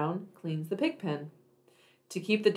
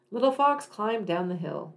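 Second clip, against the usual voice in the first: ordinary delivery, nothing marked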